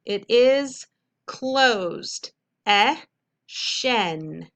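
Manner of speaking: teacher sounding out a syllable; syllable decoding. Shown as a demonstration. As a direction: sound out the vowel E then shen. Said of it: "our first syllable".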